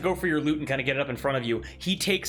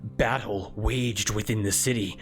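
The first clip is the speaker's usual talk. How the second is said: low, measured, halting authority; solemn cleric, stern apology; 5 semitones lower than usual. Acted. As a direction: speak gravely as a disappointed war priest. Game master plays the cleric.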